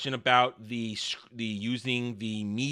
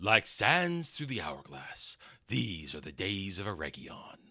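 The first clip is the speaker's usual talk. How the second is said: low, slow, soft intro; soap-opera announcer parody; 7 semitones lower, 7 dB quieter than usual. Acted. Host introducing the Eregion segment, mock-solemn low announcer. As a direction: intone it slowly like a serial opening.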